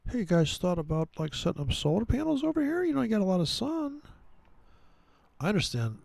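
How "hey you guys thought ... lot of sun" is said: high, fast, put-on pushy salesman voice; salesman pitch impression. Mocking the guy offering Saudis electric cars, in a high, fast put-on voice. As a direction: pitch solar panels like an eager huckster. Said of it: "He's probably gonna offer them".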